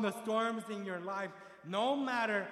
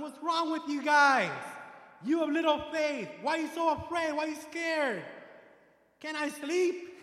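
Jesus scolding; 6 semitones higher, 6 dB louder than usual. Retold or imitated. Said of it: "Jesus tells them".

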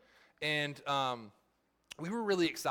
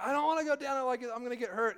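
put-on high whiny kid voice, much higher; frightened child pleading, pitched way up; scared little son; 9 semitones higher, 4 dB louder, faster than usual.

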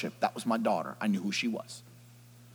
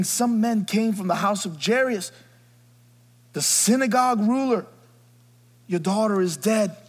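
high, ringing sung-out reading; scripture reading; 7 semitones higher, 5 dB louder than usual. Acted. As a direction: declaim the verse.

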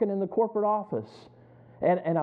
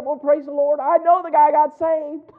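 much higher and louder, slow exultant; joyful praise; 9 semitones higher, 9 dB louder than usual. Real, not acted. Preacher retelling his joy that his coworker got saved, exultant. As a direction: shout it out like good news in church.

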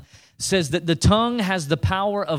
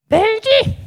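very high, loud screech of a grandma; grandmother's voice; 8 dB louder than usual. Acted. Preacher imitating his snuff-dipping grandmother, high loud call. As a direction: imitate a granny calling out, pitched way up. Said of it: "My grandmother talked like this".

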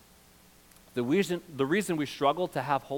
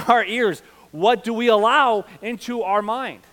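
raised, loud pulpit voice, building in urgency; forceful preaching; 7 semitones higher, 8 dB louder than usual. Real, not acted. Pastor challenging church about what fills their minds, forceful preaching. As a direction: press the challenge on the whole room.

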